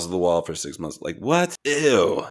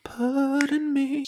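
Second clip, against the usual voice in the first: a falsetto echo of the jingle; high squeaky echo; slower than usual. Pretend.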